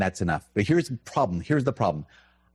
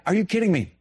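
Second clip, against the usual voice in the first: higher, incredulous retort; exasperated; 9 semitones higher, faster than usual. Retold or imitated. Priest retelling his own annoyed reaction, higher. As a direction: scoff in disbelief. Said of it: "'Cause I was like".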